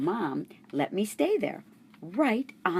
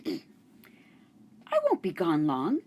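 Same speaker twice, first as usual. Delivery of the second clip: put-on reassuring girl; much slower than usual. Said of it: "Miranda told me".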